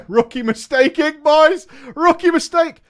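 hammed-up cocky boyfriend; mock gloating quote. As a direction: crow it like a cocky gamer. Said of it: "he replied".

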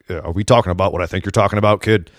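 low, quiet, knowing mock Vince; hint of a sly boss asking; imitating Vince. Wrestling host voicing Vince's look at Miz.

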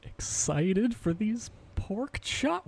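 slow, stretched, rising up high; drawn-out excitement. Acted. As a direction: stretch every word with glee. Gushing about dinner.

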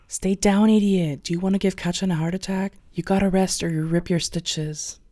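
urgent hushed shout; whisper-shout the warning; whisper yelling. A narrator's reading. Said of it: "and whisper shout".

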